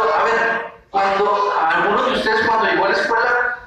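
ordinary talk, nothing marked

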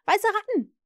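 put-on high, loud child's shout; very excited. A narrator's reading. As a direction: blurt out the guess eagerly. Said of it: "rief Nips ganz aufgeregt".

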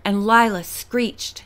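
usual speaking voice

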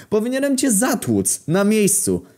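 slow threat pitched very high; acted high-voiced fury; outraged threat. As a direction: threaten the thief at the peak of outrage. Victim threatens the thief.

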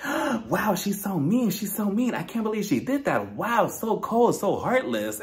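high-pitched pretend outrage; mock shock. Mimics audience's pearl-clutching shock.

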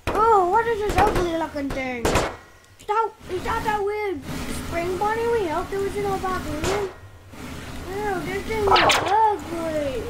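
high, loud disgusted exclamations. Acted. Disgusted at ugly animatronic.

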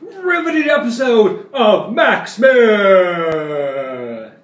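loud hyped show-host announcement; announcer intro. Acted. Vlogger opening his own show as a loud announcer.

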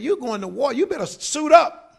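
a drill instructor barking at recruits to suit up; barked military order. Retold or imitated. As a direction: bark it like a sergeant suiting up the troops. Quoting military command.

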